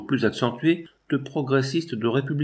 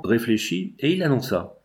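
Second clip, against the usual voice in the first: ordinary delivery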